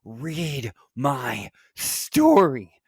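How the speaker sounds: high-pitched, drawn-out mad wail; screaming like a lunatic, dragging out each word; crazed demand, stretched very slow; screamed demand. A narrator's reading.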